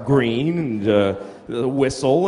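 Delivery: ordinary talk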